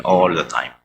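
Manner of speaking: usual speaking voice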